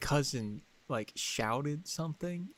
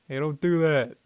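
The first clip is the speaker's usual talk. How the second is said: called-out retort; 3 semitones higher, 9 dB louder than usual. Retold or imitated. Retold bystander calling to him.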